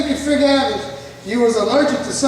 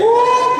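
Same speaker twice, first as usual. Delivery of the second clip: high loud whoop recalling the pain; painful spinal tap remembered, high loud woo; 11 semitones higher, 6 dB louder than usual. Retold or imitated. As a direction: let out a sharp high woo at the memory.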